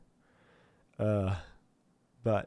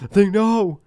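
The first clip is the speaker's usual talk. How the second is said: mock panic; 9 semitones higher, 8 dB louder than usual. Imagined panic after gasp.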